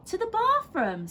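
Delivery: high, sing-song mum voice; mother voice. A narrator's reading. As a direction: mum brightly dodging the question. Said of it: "said Missus Large".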